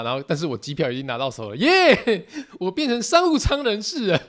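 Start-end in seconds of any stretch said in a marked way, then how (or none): 1.6-4.2 gleeful cheer. Real, not acted. Got business class upgrade.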